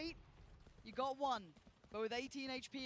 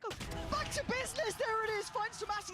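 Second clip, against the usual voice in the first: excited; 9 semitones higher, 7 dB louder than usual. Real, not acted. Caster hyping a clutch play.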